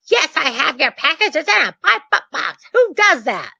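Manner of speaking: high-pitched, exasperated imitation; mad bank rep. Bank rep answering about the package, mock angry. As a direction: answer back in mock outrage. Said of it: "she was so mad".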